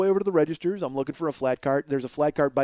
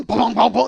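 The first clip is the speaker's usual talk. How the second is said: high, loud "bong" thuds; imitated crashing; 5 semitones higher, 5 dB louder than usual. Storyteller mimicking drawers hitting floor, loud bongs. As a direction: bang out each bong.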